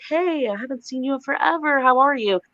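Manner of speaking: high, sing-out friendly greeting as the mom; possessed mom, chatty. Retold or imitated. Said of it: "starts talking all funny like she's possessed".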